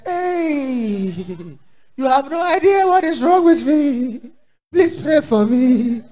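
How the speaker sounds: high, drawn-out plaintive whine; hammed-up pleading, slow and high; mock pitiful excuse. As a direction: feign suffering to get out of church. Acts member excusing absence to leader.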